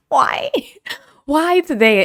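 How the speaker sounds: high, whiny exasperation; exasperated why. Retold or imitated. To herself about the stranger on the bus, high exasperated whine.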